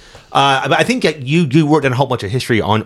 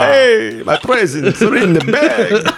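high, slow jokey Santa, laughing; 9 semitones higher, much slower than usual. Pretend. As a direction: play a goofy Santa. Imitating Santa in fun.

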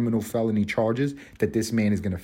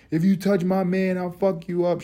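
mock angry woman; 9 semitones higher than usual. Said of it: "the second somebody came in to stop it".

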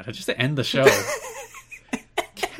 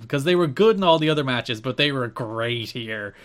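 ordinary talk, nothing marked

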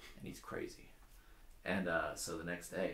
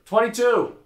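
high, loud call across a jail; yelled number; 17 dB louder, slower than usual. Acted. Comic telling a joke as an inmate calling to the jail, yelled number. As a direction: holler it out high and hard. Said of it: "someone yells out a number".

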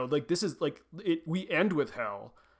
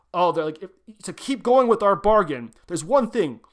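louder, higher mock devil laying down the rule; mock devil decree; 5 semitones higher, 7 dB louder than usual. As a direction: announce the bargain's rule grandly, hamming it up. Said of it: "And the devil's like".

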